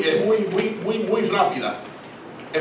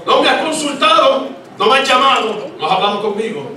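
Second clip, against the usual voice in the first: high, loud, sarcastic rebuke; God reproaching sarcastically; 10 semitones higher, 6 dB louder than usual. Acted. The Lord scolding a believer who claims to need Him, sarcastic rebuke. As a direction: throw their words back at them. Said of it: "El Señor dice".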